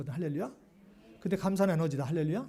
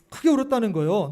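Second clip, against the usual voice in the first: 크게 punched out much louder; stress on 크게; 8 dB louder than usual. Real, not acted. Preacher stressing John's loud weeping, emphatic on 크게. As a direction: hit 크게 with a burst of volume.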